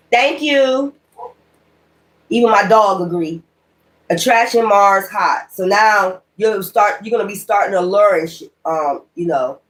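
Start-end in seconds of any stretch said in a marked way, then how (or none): none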